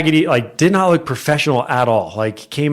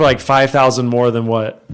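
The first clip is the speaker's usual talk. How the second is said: ordinary delivery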